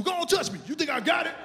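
defiant dare. Acted. Acts out inviting a pat-down.

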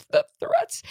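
the threats squeaked very high and choked, like a fake crying man; mock sobbing official. Mocking Garland's emotional delivery.

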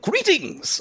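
high-pitched one-word salutation; Yim's greeting. Acted. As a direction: sing out a high, formal greeting. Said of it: "Yim's like".

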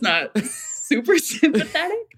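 slow, high, giggling through the words; laughing mock reply. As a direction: laugh it out while mimicking the crowd. Retelling mocking reaction, laughing.

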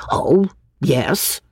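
whispered, grudging grumble; a breathy sulky grumble from a young man; grumbled. A narrator's reading. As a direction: mutter it under your breath, sulking. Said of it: "he grumbled".

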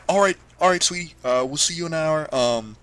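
a parent covering panic with sweetness; sugary parent voice. Acted. Parents voiced, fake soothing.

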